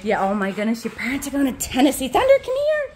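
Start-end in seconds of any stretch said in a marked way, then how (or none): 2.1-2.9 high sing-song call to the dog; high, coaxing call to Thunder. Real, not acted. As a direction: call him over, pitched up high. Calling the dog.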